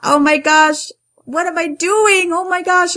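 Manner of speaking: high, loud retold panic; freaking out. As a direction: act out a panicked outburst. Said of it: "I was freaking out".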